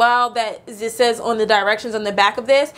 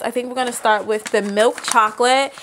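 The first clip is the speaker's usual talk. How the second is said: ordinary delivery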